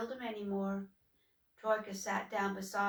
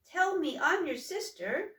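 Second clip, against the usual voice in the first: much higher and louder, pressing him; worried sister; 8 semitones higher, 5 dB louder than usual. Acted. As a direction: shake him out of his gloom. Sister urges brother.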